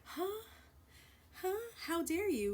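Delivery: mock pearl-clutching. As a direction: play him clutching his pearls, fake shocked. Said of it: "clutching your pearls".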